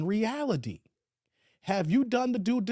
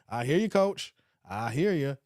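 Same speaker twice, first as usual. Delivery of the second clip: normal talking voice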